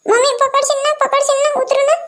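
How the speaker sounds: put-on a child checking mummy will catch her; nervous child. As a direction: ask anxiously to be caught before climbing down. Child climbing down, asking.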